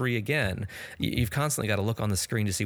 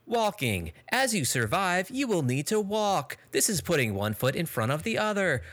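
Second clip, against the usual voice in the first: hammed-up imitation of a condescending game tutorial; mocking tutorial robot; 7 semitones higher than usual. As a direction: talk down to the player like a smug machine. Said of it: "read by an extremely unlikable and uninteresting robot".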